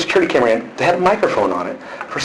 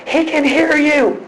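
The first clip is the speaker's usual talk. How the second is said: high-pitched, drawn-out mock mom voice; much higher, slow imitation of a mother; imitating mom; 3 dB louder, much slower than usual. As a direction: mimic mom in a high, stretched singsong. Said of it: "And she goes".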